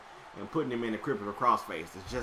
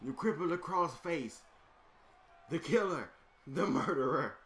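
hype announcer; 2 semitones higher, 4 dB quieter than usual. Acted. Announcing the opponent's return from hell, booming and dramatic. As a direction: sell the villain's arrival to the crowd.

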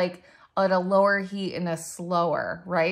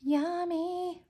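high cutesy yummy; 11 semitones higher, 5 dB quieter than usual. Pretend. High-pitched playful exclamation.

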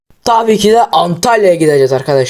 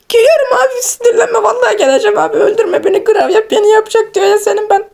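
much higher, squeaky whimpering plea; scared pleading. Acted. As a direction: beg big brother not to hurt you, with a sob and a nervous laugh. Scared friend pleads not killed.

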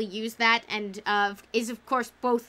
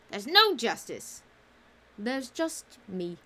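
Death's voice; slower than usual. A narrator's reading. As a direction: speak it slowly, like Death himself. Death speaking, quoted by reader.

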